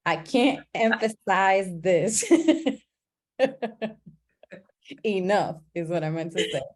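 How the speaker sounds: slowed, laughing correction of a slip; chuckling through the correction; laughing self-correction. Shown as a demonstration. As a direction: fix the wording with an embarrassed laugh. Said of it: "that was not proper English".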